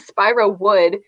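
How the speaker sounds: ordinary talk, nothing marked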